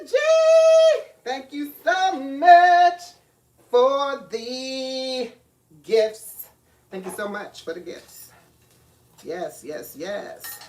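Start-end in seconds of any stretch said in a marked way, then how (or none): 0.0-6.5 excited shout-out. Real, not acted. Greeting a viewer loudly.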